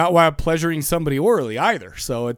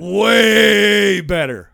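WAY drawn out very long, loud and raised; emphatic stretch; 5 semitones higher, 8 dB louder, much slower than usual. Real, not acted. Host hyping a film over the original, "way" hugely drawn out. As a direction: stretch "way" right out, loud and high.

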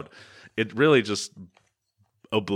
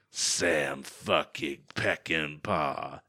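low, soft and slow put-on voice; gruff henchman voice; 6 semitones lower, 8 dB quieter, much slower than usual. Host voices the beaten guy naming his boss, low and slow. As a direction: drag it out like a beaten thug. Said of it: "And the guy says".

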